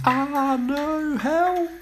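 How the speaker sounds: high, frightened cries for help; panicked cries. A narrator's reading. Girls fleeing the crumbling school, crying out high. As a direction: squeal in terror.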